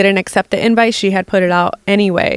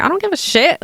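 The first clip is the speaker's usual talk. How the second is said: flung out much higher, dismissive; defiant shrug; 10 semitones higher, faster than usual. Retold or imitated. Chelsea brushing off the cast's threat, high dismissive sneer. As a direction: toss it off with a high careless sneer. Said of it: "she's like".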